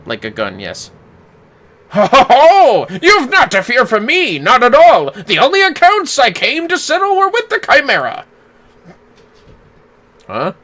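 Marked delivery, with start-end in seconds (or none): 1.9-10.5 jolly theatrical gentleman booming a laugh; voicing a cutscene hero with grand flourishes; hammy nobleman. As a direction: play a pompous, good-humoured father figure. Voices game character Godbert.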